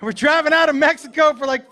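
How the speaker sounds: usual speaking voice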